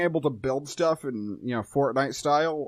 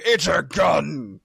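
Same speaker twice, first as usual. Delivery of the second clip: grizzled voice; 3 semitones higher, 6 dB louder, much slower than usual. Retold or imitated. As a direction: growl it out like a grizzled action hero. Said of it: "some dude in a grizzled voice be like".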